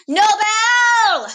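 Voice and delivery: high, loud drawn-out call; long rising-then-falling shout; hollered name. Retold or imitated. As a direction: yell it to summon a friend. Calling out to her friend.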